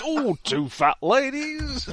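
slow, drawn-out bingo call; bingo caller. Acted. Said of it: "great with a bingo caller on the side".

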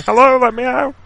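high muffled cry from a crypt; trapped voice calling out. Retold or imitated. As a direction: call out from inside the tomb. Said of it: "banging on the inside of the crypt going".

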